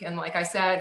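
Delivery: ordinary talk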